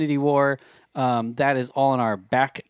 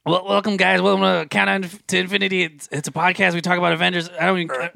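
Rick voice; 5 semitones higher than usual. Pretend. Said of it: "do it like if he was talking".

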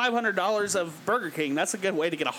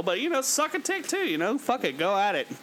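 ordinary talk, nothing marked

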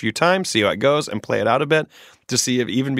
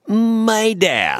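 high, loud ominous reveal; dramatic reveal; 12 semitones higher, 4 dB louder than usual. Acted. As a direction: land it like a twist. Dramatic letter reading.

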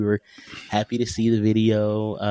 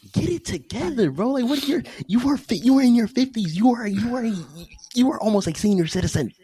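exasperated scolding. Retold or imitated. Father scolding drunk cousin in his fifties, high and exasperated. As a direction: scold him up high in disbelief.

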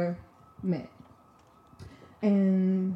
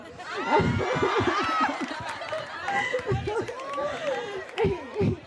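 high, long burst of laughter; big laugh; 14 semitones higher than usual. Real, not acted. Storyteller to audience about her crush, high laughing fit. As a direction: crack up helplessly.

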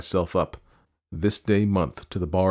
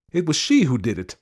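pointing, higher urgent accusation; mild outcry; 8 semitones higher, 4 dB louder than usual. A narrator's reading. Ferryman accuses the lady before the constable, mild outcry. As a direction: accuse her, a touch raised. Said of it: "I cried".

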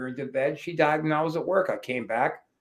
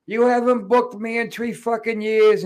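higher, louder mock drunken snarl; mimicking drunk Jimmy's rant; 8 semitones higher, 6 dB louder than usual. Drunk Jimmy cursing out the promoter, mock angry and higher. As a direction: throw the drunk wrestler's insult back, hammed up. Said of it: "'cause he's like".

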